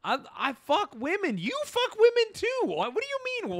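high-pitched baffled protest; mock incredulous. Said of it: "she's looking at him like".

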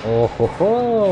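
a sly drawn-out ho-ho; drawn-out gleeful laugh. Real, not acted. Player entering a hidden spot, drawn-out gleeful laugh.

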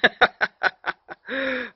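high burst of laughter; laughing. Real, not acted. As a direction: laugh at your own joke. Laughing at his own joke.

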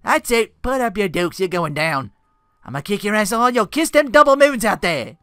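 put-on challenging British-accented shout. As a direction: roar it like a Brit ready to fight. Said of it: "with an accent".

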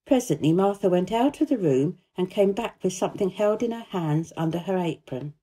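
ordinary delivery

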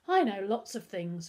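ordinary delivery, nothing marked

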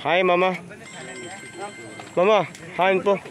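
ordinary talk, nothing marked

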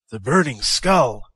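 ordinary delivery, nothing marked

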